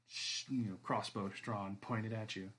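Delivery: usual speaking voice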